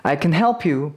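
weak CAN, stress landing on HELP; unstressed can. Shown as a demonstration. Teacher contrasting positive with negative, unstressed can. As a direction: skim over 'can' to hit 'help'. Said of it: "강세가 없으면 긍정일 때가 많고".

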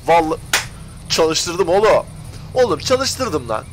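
triumphant cry. Real, not acted. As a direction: shout of relief when it finally works. Engine finally starts.

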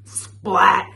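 loud, punchy splat; exclaimed sound word. A narrator's reading. Storyteller voicing mud landing, loud and sudden. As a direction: fling it out suddenly.

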